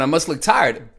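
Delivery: ordinary talk, nothing marked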